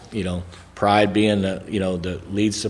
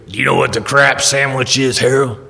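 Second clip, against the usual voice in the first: imitating an old bluesman-like mentor, raised pitch; Lewis Trotter; 4 semitones higher, 4 dB louder than usual. Lewis quizzing Harold about the crap sandwich, mentor's voice. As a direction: put on the mentor's voice, pointed question. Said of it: "It's what he sounded like".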